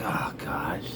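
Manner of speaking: hushed, laughing scorn; whispered eye-roll; whispered disgust. Retold or imitated. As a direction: sneer it under the breath. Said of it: "I'm like".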